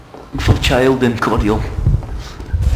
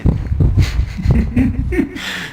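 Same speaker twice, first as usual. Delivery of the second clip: a high-pitched giggle. Real, not acted. Storyteller cracking up at his own tale, high giggle. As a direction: break into a squeaky laugh.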